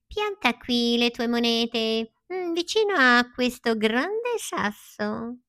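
high, slow smirking fox; sly smile. Acted. Fox showing Pinocchio where to plant, slow and sly. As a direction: smirk through the instructions. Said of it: "con un sorriso furbo disse".

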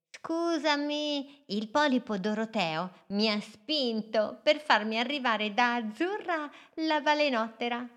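sweet small boat apologizing; little boat voice. Acted. Little boat Smolly says sorry to Amalia in high voice.

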